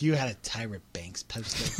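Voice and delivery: whispered tease. Retold or imitated. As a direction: breathe it in a hushed whisper. Retold friend whispering tease.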